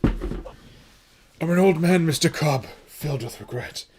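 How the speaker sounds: put-on slow, drawn-out old man voice. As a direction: drag it out like a weary old man. Quotes a film line.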